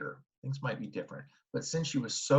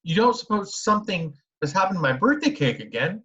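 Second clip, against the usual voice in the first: higher, worried question; fearing the cake is ruined again; girl asks mother, worried about the cake; 9 semitones higher, 7 dB louder than usual. A narrator's reading. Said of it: "she said in a worried voice".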